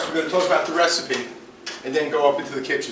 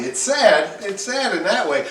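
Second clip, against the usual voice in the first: normal talking voice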